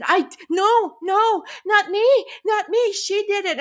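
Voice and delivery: high, flustered no; panicked denial. Retold or imitated. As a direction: wave it off in panic. Teen protesting to waiter.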